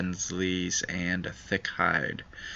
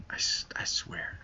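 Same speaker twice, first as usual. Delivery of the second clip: whispered, half to himself; whispered exasperation; 10 dB quieter than usual. Real, not acted. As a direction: breathe it out in disbelief. Exasperated at Melvin.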